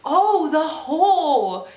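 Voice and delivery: high, loud, drawn-out dawning realisation; retold sudden realisation. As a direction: stretch it out as it finally clicks. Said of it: "and I'm like".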